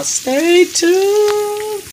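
high, drawn-out stay tuned; a high playful sign-off; teasing sign-off. Acted. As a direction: tease the viewers in a high voice.

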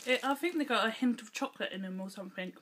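talking around candy; chewing while talking. Real, not acted. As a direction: mouth partly full. Seen 1: Lip and jaw chewing movements visible up to 4.6s, then she holds candy.

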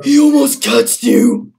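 loud, high near-miss cry; excited loud exclaim. Real, not acted. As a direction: shout the close call. Near catch in game.

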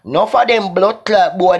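normal talking voice

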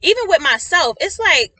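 loud, worked-up exhortation; frustrated. Real, not acted. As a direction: plead with them in real frustration. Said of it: "I'm getting a little frustrated".

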